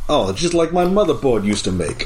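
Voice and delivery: a hammed-up tough-guy comedian bit; Andrew Dice Clay. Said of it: "doing, like, an Andrew Dice Clay thing".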